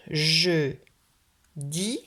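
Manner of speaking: JE and DIS as two separate beats; "je", pause, then "dis"; split into syllables. Shown as a demonstration. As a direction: dictate "je dis" with a clear pause between syllables. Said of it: "essayez d'abord d'écrire".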